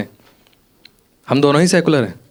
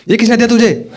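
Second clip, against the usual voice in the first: sharp raised yell, higher and a bit louder; mother yelling; 8 semitones higher, 4 dB louder than usual. Retold or imitated. Mother catches son wearing a kada, yells at him. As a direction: hit किसने hard and loud, like a mother catching her son. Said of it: "चिल्ला के बोली".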